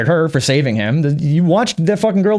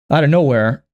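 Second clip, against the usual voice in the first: usual speaking voice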